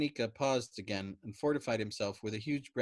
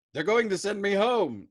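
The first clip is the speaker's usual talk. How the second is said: high, loud gleeful announcement; jubilant; 11 semitones higher, 11 dB louder than usual. A narrator's reading. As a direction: crow the good news. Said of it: "announced jubilantly".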